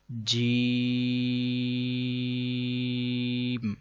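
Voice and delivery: جیم stretched into one long even tone; held for three seconds. Shown as a demonstration. Teacher shows students the three-second madd, long drawn جیم. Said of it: "تین second لمبا کریں گے".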